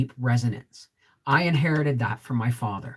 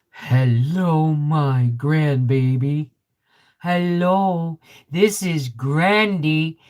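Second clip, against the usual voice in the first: put-on tender greeting to baby; 4 dB louder, much slower than usual. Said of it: "and I went".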